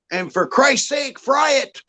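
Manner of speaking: high, rising mock shout breaking into laughter; exasperated mock plea. Friend begging the Germans to fry the sausage, mock exasperated. Acted punchline of sausage story.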